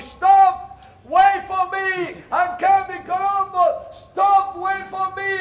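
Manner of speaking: high, loud yell after a departing ship; frantic high-pitched shouting across the water; desperate shout. Retold or imitated. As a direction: scream at the ship to wait for you. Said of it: "I just shouted".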